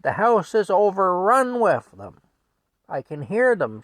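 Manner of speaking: loud, high, exasperated complaint; worked-up wife yelling. Retold or imitated. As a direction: holler it at your husband. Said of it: "she yells at Frank".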